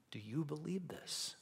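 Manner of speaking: slow breathy whisper. A narrator's reading. Jesus asking Martha, whispered slowly. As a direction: whisper the question close to her ear. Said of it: "he whispers in her ear".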